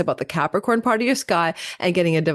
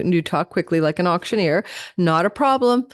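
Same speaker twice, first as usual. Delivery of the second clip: ordinary talk, nothing marked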